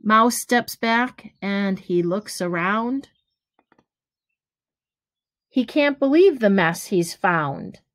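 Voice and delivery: gentle storybook read-aloud, each rhyme given room; reading to children. Acted. Teacher reading a picture book to kids, lively storytelling. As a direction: read it like bedtime story time for little ones.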